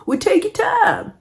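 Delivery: drawn-out high impression of dad being patient; imitating dad. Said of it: "He was like".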